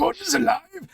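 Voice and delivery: booming Brian Blessed bellow; hammed-up booming actor voice; Brian Blessed impression. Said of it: "Brian Blessed!".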